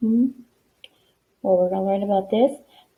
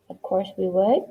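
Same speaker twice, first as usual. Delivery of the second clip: ordinary delivery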